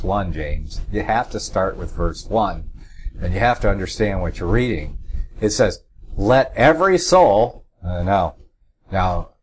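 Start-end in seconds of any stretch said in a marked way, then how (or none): 6.2-7.6 "every" lifted high and punched; stress on "every". Shown as a demonstration. Correcting James's reading of the verse, stress on "every". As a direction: hit "every" firmly, higher pitched. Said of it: "I'm emphasizing the word every".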